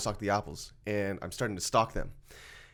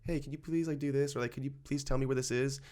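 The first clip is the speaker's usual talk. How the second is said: imitated polite asking, higher and softer; polite request; 3 semitones higher, 6 dB quieter than usual. Voicing most members asking a store worker for help, polite request. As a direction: ask softly and courteously, as a quote.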